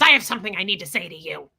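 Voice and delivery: brisk, a touch of a stern boss; a hint of a commanding assistant; mild mock stern. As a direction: imitate her turning bossy. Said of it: "dominating control mode".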